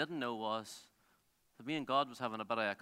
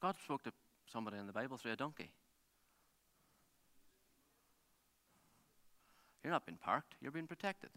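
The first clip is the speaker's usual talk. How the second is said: hushed, lower voice with long pauses; soft pastoral appeal; 5 semitones lower, 9 dB quieter than usual. Real, not acted. Preacher closing and asking how God is speaking, soft pastoral appeal. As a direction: bring it right down, leaving space for reflection.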